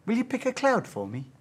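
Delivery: lightly voiced child asking a favour; young daughter's request. Daughter asks dad to pick her a cloud, lightly childlike. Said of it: "then she would say to me".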